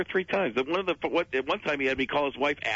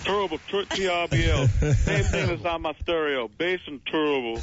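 higher, stretched terrible, lightly voiced; Barkley's terrible; 5 semitones higher, 3 dB louder than usual. Answering the cohost, Barkley says terrible. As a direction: chew on the word terrible. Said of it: "Is he terrible, though?".

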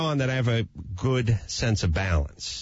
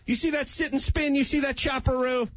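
high-pitched mock rant to the kids; hammed-up father listing what he paid for; lecturing dad. Acted dad lecturing his kids on money.

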